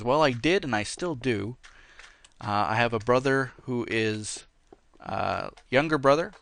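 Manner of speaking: ordinary talk, nothing marked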